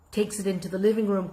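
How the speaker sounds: ordinary delivery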